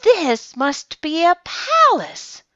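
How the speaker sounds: high, slow wonder; amazed gasp. A narrator's reading. Corduroy the bear takes the furniture floor for a palace, amazed. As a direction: draw it out, high and awestruck. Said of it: "Corduroy gasped".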